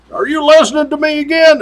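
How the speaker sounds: high, exasperated boss demanding an answer; retold captain snapping at trooper; irate captain. Angry captain quoted on speaker.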